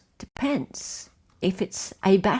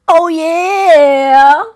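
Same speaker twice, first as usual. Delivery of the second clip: loud, high, stretched-out cheer; excited cheer; 6 semitones higher, 12 dB louder than usual. Real, not acted. Host cheers as the quiz results appear, excited. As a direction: belt it out in celebration.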